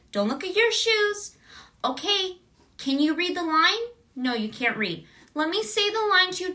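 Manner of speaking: gently wrangle a distracted kid; a hint of a sing-song director talking to a child; coaxing director.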